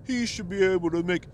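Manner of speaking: high nasal-free whiny mimic; imitating a whiny middle-aged man; mocking a whiny guy. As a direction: mimic the league whiner, whining. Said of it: "this guy is so fucking whiny".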